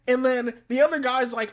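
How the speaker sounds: ordinary talk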